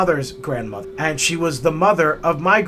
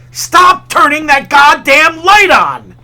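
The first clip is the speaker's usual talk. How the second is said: loud, high furious shout, slowed down; angry yell; 9 semitones higher, 10 dB louder, slower than usual. Retold or imitated. Grandfather yelling at great-grandmother over the light, furious shout. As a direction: bellow at the old lady to stop. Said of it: "And he would yell".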